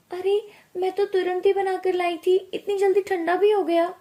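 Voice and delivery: high, quickening disbelief; astonished protest. Acted. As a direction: exclaim in baffled surprise. Young wife surprised drink went cold.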